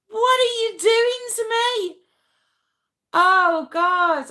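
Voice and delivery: high, loud wail of mock protest; loud, high-pitched helpless protest; exasperated outburst. Real, not acted. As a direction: cry out as if they've completely got you. Thrown by viewer, losing composure laughing.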